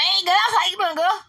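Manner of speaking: exaggerated Southern folk voice greeting a neighbor; mock country greeting. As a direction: squeal a hammed-up "Hey, girl" in a country accent. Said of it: "I'm like".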